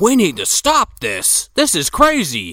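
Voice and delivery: high, slow, alarmed mock plea; panicked plea. Banderas pleading to halt the duel, mock panic. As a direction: beg them to stop, half-hammed.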